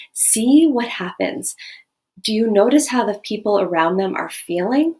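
normal talking voice

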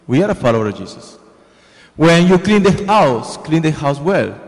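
normal talking voice

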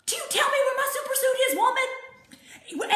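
high, fast, laughing mock outrage; Frozone indignant. Frozone demanding his wife tell him, fast laughing outrage. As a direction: rattle it off in hammed-up disbelief. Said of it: "He's like".

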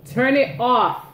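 loud firm command; stern order. Real, not acted. Mom repeating order to kid.

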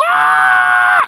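very high loud shriek; mock panic shriek of a child; daughter's shriek. Daughter freaking out.

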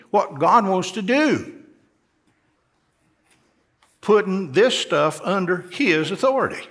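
fiery preaching. Real, not acted. Preacher rising to full force.

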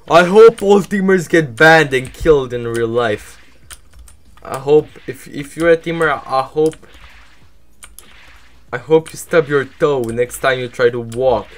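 loud, high, furious cursing; angry rant. Real, not acted. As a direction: rage at the teamers. Cursing teamers after dying.

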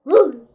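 startled yelp. Real, not acted. Jump scare.

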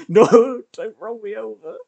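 high, loud protesting yelp; high-pitched squeal of mock distress; playful panic. Real, not acted. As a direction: cry out in laughing alarm. Game panic at being rolled over.

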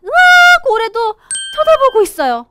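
high, loud startled cry, then a quick line; hammed-up scare, loud and high; acted alarm. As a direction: gasp in storybook fright at being watched. Alarm at whale staring in.

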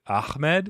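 "Ahmed" with a raspy kh for the h; throaty pronunciation attempt. Shown as a demonstration. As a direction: say "Ahmed" as Akh-med, trying the Arabic way. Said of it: "I've heard some folks pronounce it".

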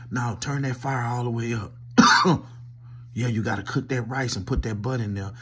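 ordinary talk

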